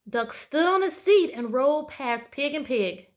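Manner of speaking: put-on loud, bright read-aloud to children; lively picture book narration; storybook narration. A narrator's reading. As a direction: read it big for the class.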